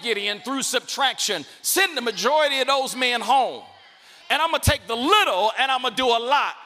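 loud, driving sermon cadence; full-force preaching. Acted. Pastor voicing God to Gideon, preaching at full force. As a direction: preach it hard to the crowd.

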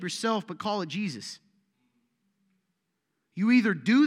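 loud, driving sermon delivery; forceful preaching. Acted. Pastor preaching loudly to his church, forceful. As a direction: preach it hard to the congregation.

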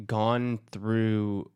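normal talking voice

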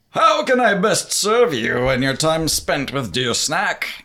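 slow, courtly servant voice; bow deeply as an eager genie. Acted.

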